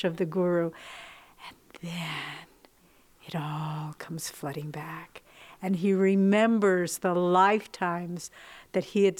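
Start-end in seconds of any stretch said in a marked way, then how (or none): 1.5-5.2 hushed whisper. Acted. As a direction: drop to a soft breathy whisper. Hushed climax of the guru story.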